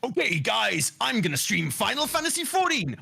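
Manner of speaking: high-pitched nineties wrestler promo; big, hammed-up wrestling announcement; Chris Metzen impression. Shown as a demonstration. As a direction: hype it up like a wrestler. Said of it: "I do a good Chris Metzen impression".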